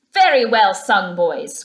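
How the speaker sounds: loud, hearty praise; hearty cry. A narrator's reading. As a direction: boom out your thanks. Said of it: "cried the rat heartily".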